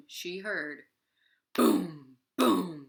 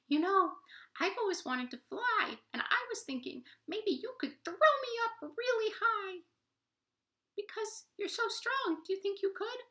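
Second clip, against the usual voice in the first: very high squeaky polite voice; tiny toad voice. Acted. Tree Toad interrupting the animals, very high squeaky voice.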